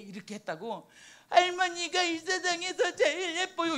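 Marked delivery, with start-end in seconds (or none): 1.3-3.8 put-on loud, high little child voice; granddaughter's voice. The granddaughter tells grandma she is the prettiest, loud child voice. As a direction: belt it out like an excited little girl.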